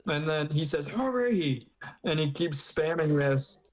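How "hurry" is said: high-pitched impatient call; playfully acted urgent order; mock urgency. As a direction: raise the pitch to hurry someone along. Acting out an urgent demand.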